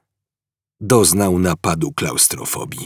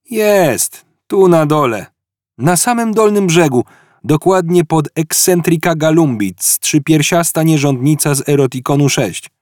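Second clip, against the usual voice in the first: higher, matter-of-fact character voice; Ford's voice; 7 semitones higher, 4 dB louder than usual. Acted. Ford shows Arthur the tiny entry, offhand character voice. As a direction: point it out like it's nothing.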